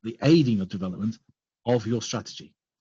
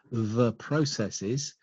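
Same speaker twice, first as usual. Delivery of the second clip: very slow, measured "the processes"; stress on processes; 4 dB quieter, much slower than usual. Shown as a demonstration. Presenter repeats the words he wants noticed, slowly stressing "processes". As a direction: spell out "the processes" slowly and deliberately, without extra volume. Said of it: "I emphasize the words".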